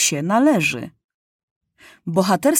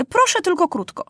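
put-on impatient boy king; 11 semitones higher than usual. A narrator's reading. Impatient king grants the floor.

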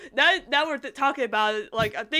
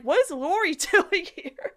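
high, laughing question; laughing disbelief; 6 semitones higher than usual. Retold or imitated. Fan reacting to Laurie in the background, laughing disbelief. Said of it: "I was like".

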